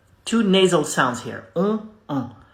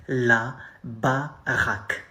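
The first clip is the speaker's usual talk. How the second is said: La baraque drawn out slowly, each syllable separated; slow teaching model; slow demo; 4 semitones lower, slower than usual. Shown as a demonstration. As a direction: spell La baraque out slowly for learners. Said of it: "slang way to say a house".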